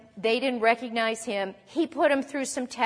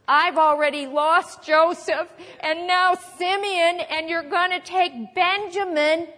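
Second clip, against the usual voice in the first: higher, louder, slow wail of loss; lamenting Jacob; 6 semitones higher, 5 dB louder, slower than usual. Retold or imitated. Preacher voicing Jacob mourning his lost sons, slow wail. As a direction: cry it out like a grieving old father. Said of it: "He is feeding his emotions".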